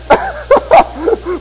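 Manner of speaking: high burst of real laughter; hearty laugh. Real, not acted. Preacher amused at not inheriting the drawl, real high laugh. Laughs at his own joke.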